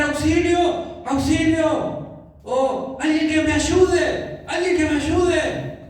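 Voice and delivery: high, drawn-out yells of auxilio; panicked cry for help. Pretend. Preacher acting a man in the street yelling for help, high drawn-out cries. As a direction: cry out like someone lost in the street. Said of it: "empiezo a gritar".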